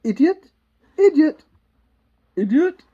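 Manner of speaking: loud, high burst of scorn; haughty outburst. Acted. Queen rehearsing her insult, loud and scornful.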